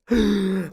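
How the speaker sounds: imitated gasp of someone jolted awake; startled waking gasp. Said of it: "like, woken up".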